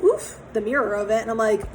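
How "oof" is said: mock oof.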